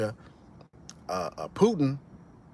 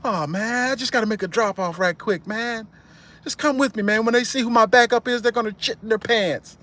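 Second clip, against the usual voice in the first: put-on much higher, boastful young voice; cocky kid; 9 semitones higher than usual. A cousin bragging to Carlito, high cocky voice. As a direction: brag like a goofy teenager.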